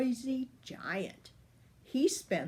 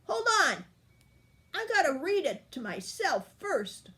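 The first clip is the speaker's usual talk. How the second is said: high, loud, bossy wolf brushing him off; gruff wolf; 9 semitones higher, 4 dB louder than usual. A narrator's reading. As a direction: stall the giant like a pompous wolf. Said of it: "growled the big bad wolf".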